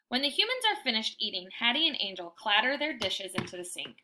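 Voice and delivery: plain reading voice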